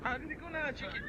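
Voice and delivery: high soft coaxing to the chicken. Retold or imitated. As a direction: coax it gently, way up high.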